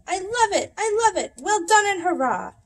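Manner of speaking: high, loud, delighted cheering; squealing with delight; excited cheer. A narrator's reading. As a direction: cheer it like a thrilled kid. Skinny Doug's excited cheer.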